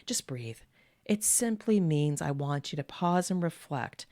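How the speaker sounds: ordinary delivery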